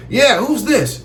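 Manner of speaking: a hint of sounding proper; a light put-on proper phone answer.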